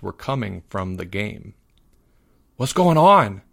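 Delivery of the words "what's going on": usual speaking voice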